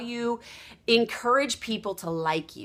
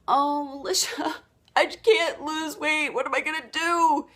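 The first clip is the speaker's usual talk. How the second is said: whiny complaint; 5 semitones higher than usual. Retold or imitated. Client moaning to Lisha about her weight, mild whine. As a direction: lightly play a discouraged dieter.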